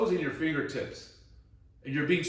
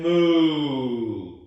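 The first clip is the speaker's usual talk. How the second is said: louder, full-voiced cow moo; big booming moo to exercise the voice; 2 semitones lower, 7 dB louder than usual. Shown as a demonstration. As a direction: moo out as loud as you can. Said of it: "So meow as loud as you can".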